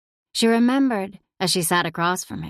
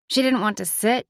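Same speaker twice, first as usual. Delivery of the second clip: ordinary delivery, nothing marked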